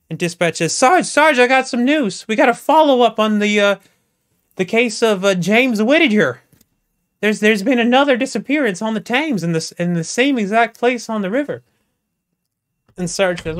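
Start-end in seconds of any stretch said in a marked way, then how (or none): 0.7-11.6 much higher and louder, breathless excitement; urgent news. Acted. Dispatch rushing the disappearance news to Sarge, high and loud. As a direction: blurt it out to the sergeant in a hurry.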